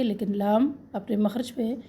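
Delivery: ordinary talk, nothing marked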